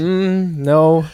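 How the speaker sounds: high, loud unimpressed "Mm, no"; dismissive no. Retold or imitated. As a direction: brush it off like a bored listener. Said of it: "they were like".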